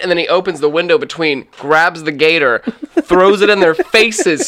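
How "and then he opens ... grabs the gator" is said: usual speaking voice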